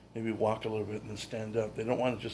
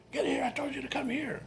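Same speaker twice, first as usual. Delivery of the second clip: mock scream. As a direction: imitate a mother screaming at her child. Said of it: "she's screaming at him".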